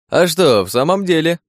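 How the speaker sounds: high, lively pickup; eagerly chiming in. A narrator's reading. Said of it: "подхватил приказный". (живо подхватывает, высоким голосом)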